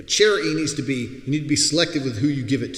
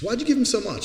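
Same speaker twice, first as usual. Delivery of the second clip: higher, lightly voiced girl; a hint of a puzzled girlfriend asking; girlfriend's question; 10 semitones higher than usual. Girl in the car questions the big tip, lightly voiced. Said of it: "She goes".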